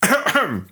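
high-pitched acted throat-clear. Rooster prepares to answer the donkey, clearing his throat. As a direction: clear the throat pointedly before announcing. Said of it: "Der Hahn räusperte sich".

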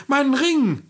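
put-on a girl's high, eager cry; quick eager answer. The miller's daughter quickly offers the little man her ring, high and eager. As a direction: blurt out the offer without thinking. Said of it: "Ohne lange zu überlegen".

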